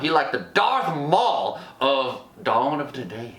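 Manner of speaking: ordinary talk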